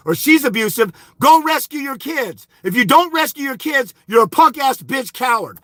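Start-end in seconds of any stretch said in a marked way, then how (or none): none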